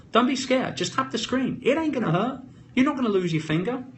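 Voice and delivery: high-pitched coaxing, as to someone scared; mock reassurance. Coaxing timid viewers to tap.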